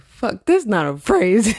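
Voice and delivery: ordinary talk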